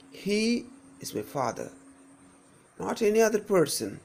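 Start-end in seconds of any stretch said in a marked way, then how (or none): 0.1-1.7 HE held high, the rest dropping; single out "he" at the start; long emphatic "he", then quick "is my father"; stress on HE. Shown as a demonstration. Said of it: "if I stress on this word".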